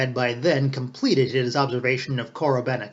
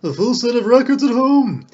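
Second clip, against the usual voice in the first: much higher and louder, thrilled admiration; delighted; 10 semitones higher, 8 dB louder than usual. A narrator's reading. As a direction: gush over the find. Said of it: "exclaimed the delighted Ostap".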